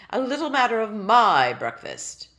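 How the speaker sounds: loud, stately reproach; a royal clearing his throat to remind you; pompous tiny king. Acted. Tiny king reminding Ellie of his breakfast, loud and stately.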